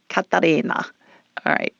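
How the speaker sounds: put-on host trying the Italian accent on a name; imitated Italian accent. Host repeating the caller's mother's name, imitated Italian accent. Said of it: "when you put the Italian accent on".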